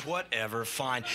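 low, soft dismissive mumble; mock resigned crew; shrugging whatever. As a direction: give up with a shrug. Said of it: "And they're like".

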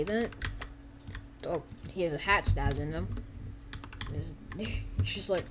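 ordinary talk, nothing marked

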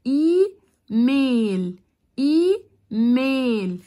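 "ايميل" very slowly split as ee, mail; long "ee", pause, long "mail" in each "ايميل"; two stretched syllables. Shown as a demonstration. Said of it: "الكلمات اللي على مقطعين". ("ايميل" إي ثم ميل بتمطيط شديد)

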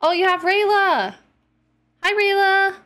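high, loud happy hello; light up at seeing the cat; excited greeting. Real, not acted.

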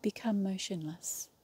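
calm yoga nidra guide with long, restful pauses; guided meditation. Real, not acted. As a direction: lead them into stillness, one unhurried phrase at a time. Leads a yoga nidra relaxation.

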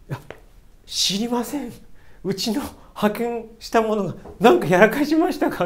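ordinary delivery

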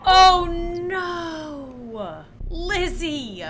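gasp, then a loud long-drawn wail; wailing dismay. Real, not acted. Player watching Lizzie lose the fight, wailing in dismay. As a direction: draw out the cry of despair.

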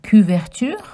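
couverture in French, rising prompt; French pronunciation. Shown as a demonstration. Asking children to repeat couverture. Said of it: "Can you say".

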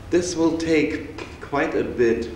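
normal talking voice

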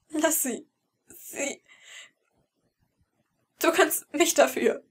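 high, pleading, voice catching; desperate plea. Acted. Brother begging the captor to spare his sister, desperate. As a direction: beg him to let her go.

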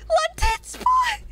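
high, slow shriek of disbelief; incredulous shriek. Real, not acted. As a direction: shriek the name in outraged disbelief. Reading chat joke incredulous.

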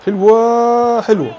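loud, high thrilled praise; excited shout. Real, not acted. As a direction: cheer it out. Celebrating a good move.

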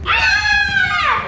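high-pitched startled "Ah!"; sharp scream. Real, not acted. Racing with new nails on, high scream. Nail race starts, she cries out.

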